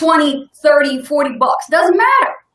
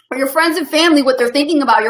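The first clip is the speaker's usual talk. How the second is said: ordinary talk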